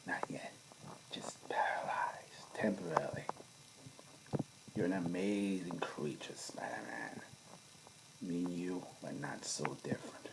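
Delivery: hushed, menacing near-whisper; soft Green Goblin whisper. Retold or imitated. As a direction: purr it softly, like a villain savouring the moment. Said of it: "soft like that".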